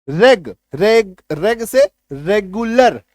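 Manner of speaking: रेग said very slowly and loud, the e vowel drawn out before the g; a slow loud "रेग, रेग" leading into रेगुलर; slow pronunciation demo. Shown as a demonstration. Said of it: "एकार की मात्रा ग". (रेग को बहुत धीरे, "ए" खींचकर बोलिए)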